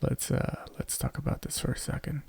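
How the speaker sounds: hushed, leaning in to the mic; soft intimate whisper to set up a story; close whisper. Pretend. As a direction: whisper it right into their ear. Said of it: "let me get real close to you here".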